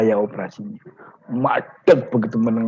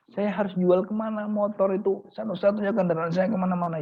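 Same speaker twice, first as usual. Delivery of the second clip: worried plea; 7 semitones higher than usual. Acted. Kiai despairs over selling.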